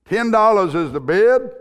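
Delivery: loud, high auctioneer's call. Acted. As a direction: announce it to the whole room. Auctioneer calls out the bid.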